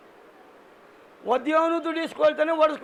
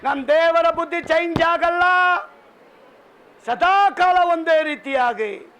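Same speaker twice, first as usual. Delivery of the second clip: raised, emphatic sermon delivery; preaching; 4 semitones higher, 4 dB louder than usual. Real, not acted. Preacher telling congregation God never changes, preaching with force. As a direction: drive each phrase home from the pulpit.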